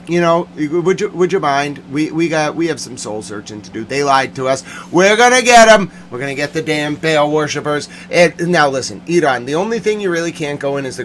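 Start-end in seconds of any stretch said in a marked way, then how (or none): 4.9-5.9 high, loud threat; angry vow. Real, not acted. Vowing to get those who lied, angry. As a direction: burst out with a vow of payback. Vows revenge on liars.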